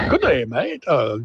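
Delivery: hammed-up waiter's would-be Irish accent; put-on Irish accent. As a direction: greet diners in a shaky-authentic mock Irish accent. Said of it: "he puts on this Irish accent".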